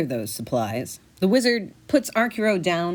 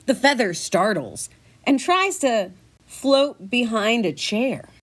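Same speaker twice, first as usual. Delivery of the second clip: usual speaking voice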